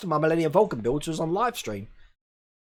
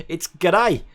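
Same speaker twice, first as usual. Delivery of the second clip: Aussie g'day; 3 dB louder than usual. Shown as a demonstration. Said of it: "There's my Aussie accent just coming out".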